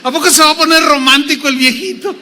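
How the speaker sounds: high, quick mocking disbelief; incredulous Sara. Sara eyes her old husband, amused incredulity. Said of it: "Y miraba al viejito y decía".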